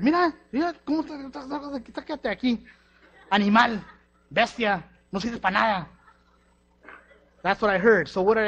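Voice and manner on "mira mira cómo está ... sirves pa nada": much higher, shouted Spanish scolding; regañando a gritos en español; mother's scolding. Retold or imitated. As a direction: berate him like a furious mother.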